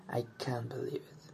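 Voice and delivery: whispered under the breath; disbelieving whisper. Acted. Commuter stuck on the stalled train, whispering in disbelief.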